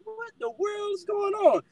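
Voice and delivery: high, slow, puzzled inner voice; bewildered thought. Retold or imitated. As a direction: act out the baffled thought, drawn out. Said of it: "I'm really thinking, like".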